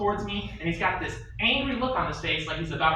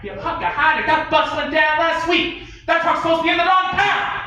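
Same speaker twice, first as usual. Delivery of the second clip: high, loud incredulous shout; disbelieving yell; 12 semitones higher, 7 dB louder than usual. Acted. The captain hearing the excuse, a high incredulous shout. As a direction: roar the disbelief.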